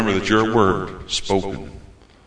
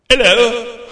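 much higher, louder, bright mock hello; cheery greeting from heaven; 11 semitones higher, 7 dB louder than usual. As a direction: call out like a friend spotting you. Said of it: "will some of you say".

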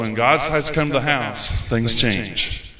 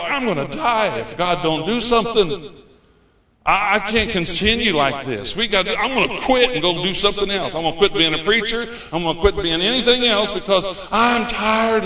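higher-pitched, fed-up desperation; desperate; 6 semitones higher than usual. Retold or imitated. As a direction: retell being at the end of your rope. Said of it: "And so I got desperate".

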